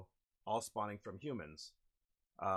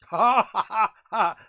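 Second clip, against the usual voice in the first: loud, high triumphant laugh; big open belly laugh; loud burst of laughter; 10 semitones higher, 19 dB louder than usual. Real, not acted. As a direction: burst out laughing at a lucky roll. Big laugh after dice roll.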